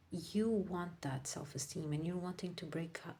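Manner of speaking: ordinary delivery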